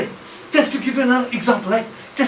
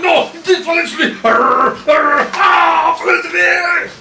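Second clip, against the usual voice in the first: excited shout; 9 semitones higher, 7 dB louder, slower than usual. Acted. Reporter shouting a name.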